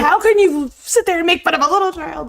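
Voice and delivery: high, loud mock indignation; mock outrage. Real, not acted. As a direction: scold in fake shock.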